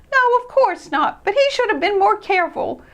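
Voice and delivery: high, hurt mouse voice; regretful and upset; upset Christopher. Acted. Christopher regretting he lent the sled.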